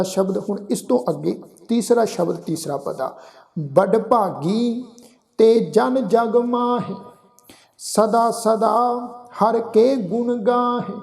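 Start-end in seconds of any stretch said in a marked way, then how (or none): 3.6-10.9 very slow, drawn-out Gurbani recitation; scripture recitation. Acted. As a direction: recite each phrase slowly, holding the long vowels. Recites scripture between explanations.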